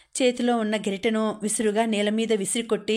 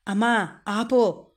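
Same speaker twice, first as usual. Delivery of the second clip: slow, drawn-out plea to stop; a mild narrated yell, stretching the words; shouted protest; slower than usual. A narrator's reading. Daughter cuts off her mother's tirade, restrained shouted protest. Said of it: "అంటూ గట్టిగా అరిచింది ఇందిర".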